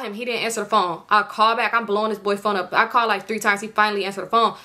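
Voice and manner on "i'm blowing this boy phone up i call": ordinary talk, nothing marked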